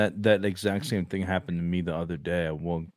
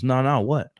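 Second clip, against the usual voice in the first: insistent what; 8 semitones higher, 6 dB louder than usual. Retold or imitated. Student insists on hearing the joke.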